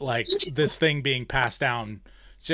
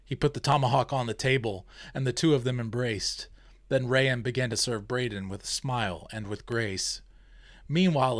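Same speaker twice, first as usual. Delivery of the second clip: reading a story aloud with voiced characters; storyteller reading, even pace; story narration; 5 dB quieter than usual. A narrator's reading. Reads her story aloud.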